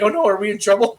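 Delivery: high, loud imitation of guilty troublemakers; mock worry. Host imitating wrestlers faking an apology, mock worry. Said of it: "They'd be like".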